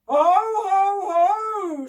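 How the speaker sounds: huge, high, booming villain's laugh; loud monster ho-ho; snarling gramophone giant. A narrator's reading. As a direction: boom out a snarling mock laugh. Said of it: "snarled the gramophone".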